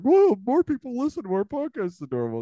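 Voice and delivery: high, bright-eyed mock amazement; mock surprise. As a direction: gasp at the listener numbers, way up high. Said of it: "it's like".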